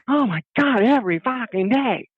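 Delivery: high-pitched exasperated groaning; exasperated husband. Retold or imitated. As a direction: act out being utterly fed up. Husband fed up after quarrel.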